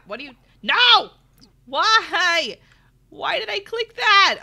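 loud, high no of dismay; dismayed yell. Real, not acted. Streamer yelling at her own wrong click. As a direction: cry out at the misclick. Misclick panic.

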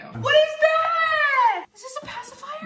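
very high, gushing squeal; excited delight. Real, not acted. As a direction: squeal over an adorable discovery. Delighted at opening baby gifts.

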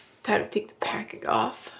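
hushed nervous whisper. Real, not acted. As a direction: whisper it, bracing yourself. Nervous take before packing removal.

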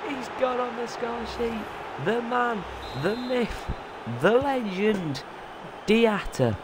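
high, slow and showy; hyped praise. Real, not acted. Player hails his scorer, high and showy. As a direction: crown the scorer like a hero.

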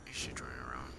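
breathless whisper while hiding. Real, not acted. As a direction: whisper so it can't hear. Hiding in the dark.

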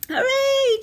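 high, loud little bear cheer. A narrator's reading. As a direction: cheer like a delighted child. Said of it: "cheered Baby Bear".

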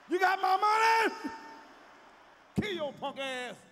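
high, loud, slow shout; mock shouted threat. Corpse grabbing a debtor's wrist, shouting. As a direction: demand your money from the coffin. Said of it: "grab their wrists".

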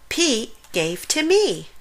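usual speaking voice

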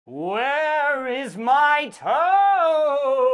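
drawn-out, groaning monster voice; eerie moaning creature calling for its toe; slow ghostly groan. A narrator's reading. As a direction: moan it very slowly from the dark. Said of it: "it groaned".

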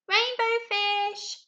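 high, loud, slow call; little fish calling. Acted. Little blue fish calling after the rainbow fish, high drawn-out call. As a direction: stretch it out to catch him. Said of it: "he called".

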